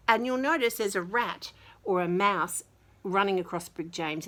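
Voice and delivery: ordinary delivery, nothing marked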